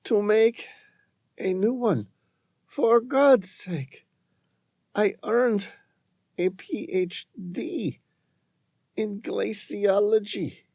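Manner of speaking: high, faint, pleading woman's voice; weak pleading woman. Acted. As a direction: plead weakly, near the end.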